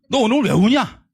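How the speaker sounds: loud, high scolding mother; fed-up mother shouting at her son; mother's exasperated shout. Retold or imitated. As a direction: snap at the crying son like a fed-up mom.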